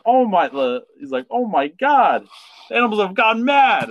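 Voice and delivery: hammed-up shocked doctor; high flustered exclamation. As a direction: cry out like a flustered dentist. Dentist voiced in retelling.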